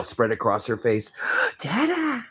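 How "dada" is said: put-on high, delighted little girl; a tiny girl greeting her father; toddler voice. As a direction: squeal it like a happy toddler. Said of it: "she said".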